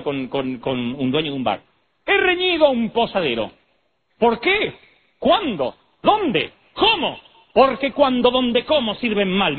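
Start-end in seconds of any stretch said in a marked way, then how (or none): 2.0-9.6 high declaiming teacher, word by word; old-time schoolteacher. Retold or imitated. Teacher drilling pupils on accent marks, mimicked schoolmarm. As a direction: fire off each question like a hammed-up schoolmistress. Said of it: "Un día llegó la maestra, dijo".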